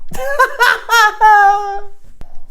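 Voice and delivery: high cackling laugh; cackle. Acted. Laughs at own show announcement.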